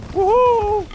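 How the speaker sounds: high gleeful woohoo; excited whoop. Real, not acted. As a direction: let out a thrilled cheer. Excited whoop while playing.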